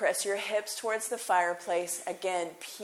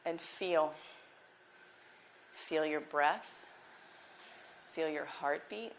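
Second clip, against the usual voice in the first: guided meditation; 3 semitones lower, 12 dB quieter than usual. Acted. Teacher guiding the class into final rest, soft meditation voice. Closing relaxation cues.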